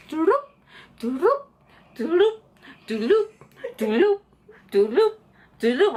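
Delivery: short rising "doo-doop" blips; playful rising sound effect, evenly spaced; imitated car noise. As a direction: toss out high little turning sounds one by one. Imitating repeated turns round block.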